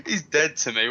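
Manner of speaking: laughing through the words. Real, not acted. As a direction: squeal it out mid-laugh. Laughing while joking.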